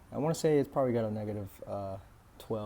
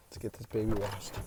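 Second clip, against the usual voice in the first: out of breath; 2 semitones lower, 3 dB quieter than usual. Real, not acted. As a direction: still winded, a bit softer. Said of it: "sorry, I'm out of breath".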